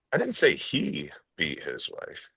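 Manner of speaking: single out the word "he"; stress on HE. Shown as a demonstration. Trainer showing how emphasis changes meaning, stress on "he". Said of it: "when I emphasize different words".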